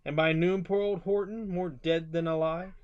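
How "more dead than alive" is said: ordinary delivery, nothing marked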